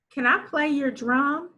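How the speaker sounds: put-on lively fluent reading for kids; fluent lilting read. Shown as a demonstration. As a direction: read it smoothly like a storybook. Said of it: "reads sentence twice".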